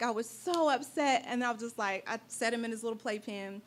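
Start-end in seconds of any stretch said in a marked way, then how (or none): none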